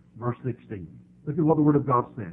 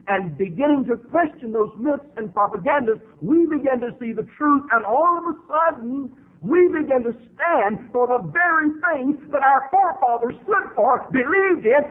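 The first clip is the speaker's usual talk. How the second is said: rising higher and louder, fervent sermon climax; preaching at full force; 11 semitones higher, 4 dB louder than usual. Acted. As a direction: build and build until the voice cracks.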